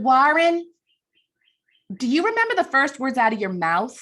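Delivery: Ann Landers in a voice that is not her Chicago one, high and loud; a first try that misses her accent; wrong accent. Retold or imitated. Ann Landers quizzes the dad in a wrong accent. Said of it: "that's a wrong accent".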